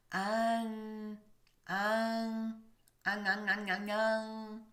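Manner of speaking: repeated "an" made far back with the tongue; French nasal un drill. Shown as a demonstration. Teacher drilling the nasal for learners. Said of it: "Can you hear the difference?".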